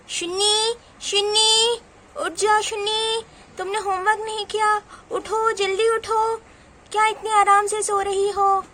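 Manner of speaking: high, loud scolding wake-up call; angry mother. Acted. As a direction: call out crossly to rouse a sleeping child. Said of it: "mummy बहुत गुस्से में है". (गुस्से में ऊँची आवाज़ से जगाती माँ)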